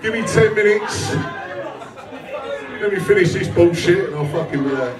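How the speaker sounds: normal talking voice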